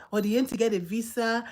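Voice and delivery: normal talking voice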